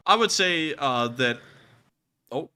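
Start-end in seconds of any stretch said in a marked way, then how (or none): 2.3-2.5 laughing. Real, not acted. Laughing at cohost's noise.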